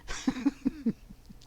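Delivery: real tickled giggle; amused laugh. Real, not acted. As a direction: chuckle at his remark. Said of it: "I'm laughing at him".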